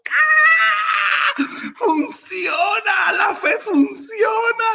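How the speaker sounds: high, loud, drawn-out cry of victory; triumphant shout. Retold or imitated. Believer learns the landlady will rent to him, triumphant shout. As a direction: shout it slowly in hammed-up elation. Acts out his triumph at her yes.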